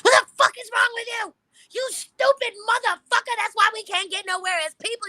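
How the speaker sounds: high-pitched, full-tilt furious tirade; snapped, going off. Retold or imitated. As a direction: rip into them at the top of your voice. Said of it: "I went, I fucking snapped".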